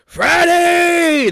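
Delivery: very high loud yell; yelling through the house. Acted. Host yelling for Franny through the house. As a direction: bellow the name up the stairs. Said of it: "then yell".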